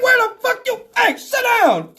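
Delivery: high, loud mock police shout; shouted cop order. Cop stopping the passenger walking uphill, shouting. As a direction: yell after someone walking away. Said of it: "Stinger yelled".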